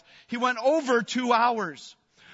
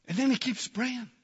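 normal talking voice

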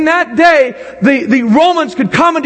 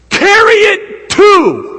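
drawn out, raised high and loud; preached high and loud; 10 semitones higher, 3 dB louder, much slower than usual. Acted. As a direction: hit the climax slowly and strongly. Climactic preaching command.

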